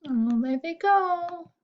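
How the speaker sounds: watch them leave, sighing; wistful high aside. Real, not acted.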